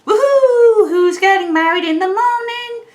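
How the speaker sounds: high-pitched, hammed-up whooping glee; over-excited. Libra carried away by wedding hopes, over-excited. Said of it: "could be that you're getting excited".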